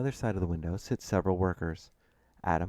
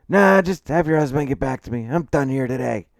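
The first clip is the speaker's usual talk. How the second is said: raised, loud, fed-up voice; angry dismissal; 7 semitones higher, 10 dB louder than usual. Acted. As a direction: wave it off loudly and be done. Client storms out dismissively.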